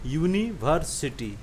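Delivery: "यूनिवर्सिटी" slowly in three parts, यूनि, वर, सिटी; split into syllables. Shown as a demonstration. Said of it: "तीन भाग में बटा गया". ("यूनिवर्सिटी" को धीरे, तीन हिस्सों में: यूनि, वर, सिटी)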